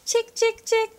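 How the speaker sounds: high, loud sing-song call of chick, chick, chick; wolf coaxing the chicks with high calls. Retold or imitated. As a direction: lure them in with a bright high call. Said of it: "I'd go".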